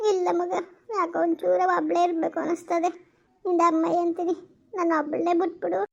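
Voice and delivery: normal talking voice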